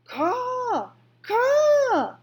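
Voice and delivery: very high, loud crow caws; crow cawing. A narrator's reading. The Pellegrina crow answers Edward, very high and loud. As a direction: screech like a crow. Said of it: "said the Pellegrina crow".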